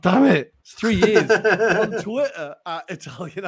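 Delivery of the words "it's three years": high and loud, laughing at himself; laughing at his own slip; laughing exasperation. Real, not acted.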